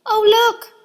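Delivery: high, loud delighted shout; children spotting a new toy; excited cry. A narrator's reading. Children crying out at the new seesaw. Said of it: "they cried".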